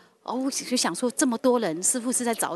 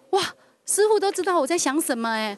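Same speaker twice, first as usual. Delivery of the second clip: higher and louder retold wow; amazed realization; 8 semitones higher, 5 dB louder than usual. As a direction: recall the rush of amazement, voice lifting. Retold amazed inner thought.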